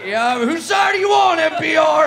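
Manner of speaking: loud, high mock challenge; frat bro shout. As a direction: yell it at the crowd like a party bro.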